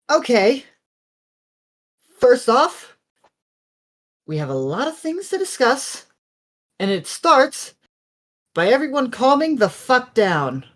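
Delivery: high, emphatic call to order; commanding. Acted. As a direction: take charge of a rowdy group. Character takes command of agitated group.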